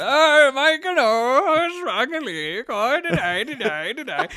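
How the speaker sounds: high, slow, lilting pseudo-Norwegian; mock Norwegian accent. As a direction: wail it slowly in a silly Norwegian accent. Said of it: "It's kinda Norwegian".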